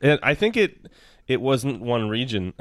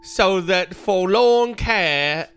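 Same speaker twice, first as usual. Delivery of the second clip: high, slow, hammed-up British accent; terrible British accent; 6 semitones higher, slower than usual. Reading one more card in the accent, high and hammily British. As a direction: do a bad Mary Poppins-era British voice. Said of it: "It's a terrible accent that I just did".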